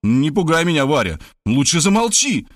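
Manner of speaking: alarmed cry. A narrator's reading. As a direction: shut her up in shocked alarm. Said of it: "а потому закричал". (встревоженный вскрик, повышенный голос)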